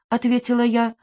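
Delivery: ordinary delivery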